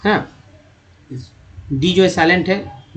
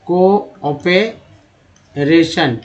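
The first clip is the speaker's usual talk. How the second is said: Cooperation stretched very slowly; very slow model word; much slower than usual. Shown as a demonstration. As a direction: drag out cooperation syllable by syllable. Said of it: "को क्या बोलते हैं". (Cooperation बहुत धीरे खींचकर)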